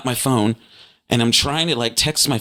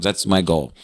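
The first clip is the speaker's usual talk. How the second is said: ordinary delivery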